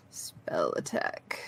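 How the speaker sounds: near-whisper, slow and low; muttering to self. Real, not acted. Player hunting the sheet for a number, muttering to self. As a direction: murmur it while searching.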